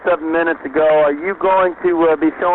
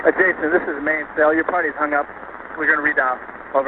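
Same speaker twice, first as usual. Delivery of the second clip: crackly military radio; 6 dB quieter than usual. Real, not acted. Radio call from main cell.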